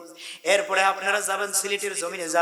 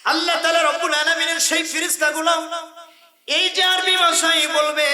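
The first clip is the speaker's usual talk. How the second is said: higher, louder preaching shout; full-force preaching; 9 semitones higher, 7 dB louder than usual. Real, not acted. Preacher warns of the angels, high and loud. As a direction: hammer the point home.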